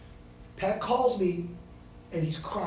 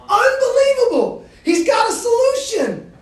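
much higher and louder, laughing joy; exultant shout; 16 dB louder than usual. Real, not acted. Preacher to students, rejoicing over Jesus' solution, laughing shout.

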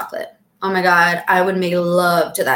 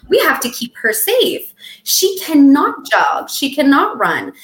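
higher, slow alarmed inner voice; mock protective mind; 5 semitones higher, slower than usual. Inner caveman mind warning her off jogging, mock alarmed. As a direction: play the fretting caveman brain, pitched up.

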